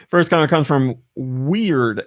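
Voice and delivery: normal talking voice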